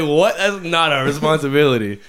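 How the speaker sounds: high, loud mock indignation; retold disbelief. Shoe store staff asked to explain money, loud mock protest. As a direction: protest loudly, as if unfairly roped in.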